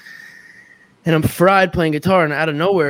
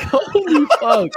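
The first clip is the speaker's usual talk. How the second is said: very high, drawn-out disbelief; retold astonishment; 4 dB louder, slower than usual. Man reacting to the intruder's proclamation, high stunned voice. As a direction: stretch it out high, mock-stunned.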